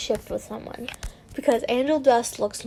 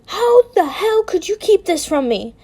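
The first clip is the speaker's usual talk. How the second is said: high, raised accusing voice; angry spitting; 8 semitones higher, 5 dB louder than usual. A narrator's reading. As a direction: spit it out at your husband, outraged. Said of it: "I spat".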